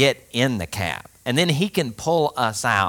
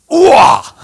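a sudden high, loud whooping cry; loud high whoop to get the driver moving; 14 semitones higher, 14 dB louder than usual. Retold or imitated. Friend's trick to hail cab.

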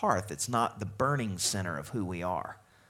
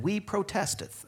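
high-pitched, hammed-up objection; mock protest; 10 semitones higher than usual. Church folks answering the preacher, in high mock protest. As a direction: imitate a congregation objecting, pitched way up. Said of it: "and you said".

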